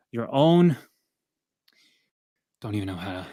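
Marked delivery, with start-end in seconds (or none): 2.6-3.3 breathless and trailing off; winded, running out of air; out of breath. Real, not acted. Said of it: "I'm out of breath right now".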